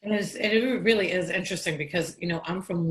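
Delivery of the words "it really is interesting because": normal talking voice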